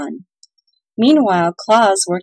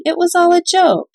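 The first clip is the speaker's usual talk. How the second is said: higher, louder nervous laugh-off; a jittery old elder brushing it off; 10 semitones higher, 5 dB louder than usual. A narrator's reading. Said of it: "said an elder nervously".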